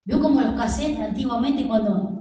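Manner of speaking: ordinary delivery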